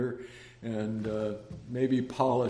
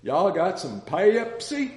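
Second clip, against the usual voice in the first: put-on Texan accent; 6 semitones higher, 5 dB louder, slower than usual. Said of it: "this guy walked up to and said".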